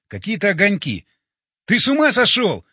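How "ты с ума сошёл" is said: loud, high outraged yell. A narrator's reading. Hero yells back at Боря by the hole, outraged yell. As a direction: yell it in disbelief. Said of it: "заорал я".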